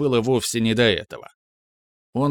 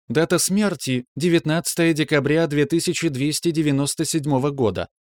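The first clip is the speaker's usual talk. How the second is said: ship computer voice; 4 semitones higher than usual. Acted. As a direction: report evenly like a ship's AI. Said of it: "продолжал мягкий женский голос".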